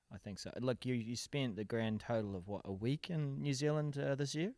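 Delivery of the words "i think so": quiet aside. Real, not acted. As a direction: murmur it to yourself. Quiet echo of his own agreement.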